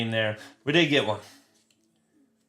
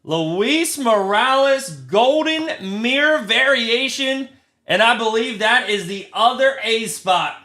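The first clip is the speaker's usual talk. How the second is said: excited; 8 semitones higher, 4 dB louder than usual. Real, not acted. Streamer announcing his first rare parallel, high and loud. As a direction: savour the name like a prize.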